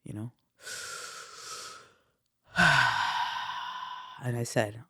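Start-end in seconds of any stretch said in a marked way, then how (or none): 2.5-4.0 long audible exhale; deep breath. Retold or imitated. Speaker reliving the moment before letting go, long deep exhale. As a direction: re-enact a deep breath let slowly out. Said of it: "I took a deep breath".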